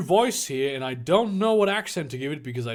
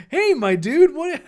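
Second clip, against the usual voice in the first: loud, high, laughing hello; thrilled, laughing shout-out; excited greeting; 12 semitones higher, 8 dB louder than usual. Real, not acted. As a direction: greet a friend with real delight. Greets the viewer just spotted.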